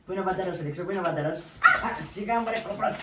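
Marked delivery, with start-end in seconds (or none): 1.4-2.9 sudden high cry, then a loud scolding; man startled by his dog jumping up; startled yelp. Acted.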